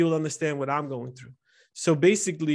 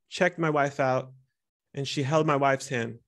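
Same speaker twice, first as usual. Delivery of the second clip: normal talking voice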